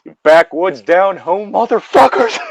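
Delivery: angry yell. Retold or imitated. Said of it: "It's just like".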